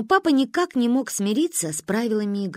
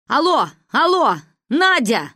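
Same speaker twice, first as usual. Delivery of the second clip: loud, high call down the line; shouting through static; 6 semitones higher, 5 dB louder than usual. A narrator's reading. Father calling mother over a bad line, shouting. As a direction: call out to break through crackle. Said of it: "Сквозь шорох и треск прорвался голос".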